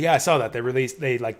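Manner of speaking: ordinary delivery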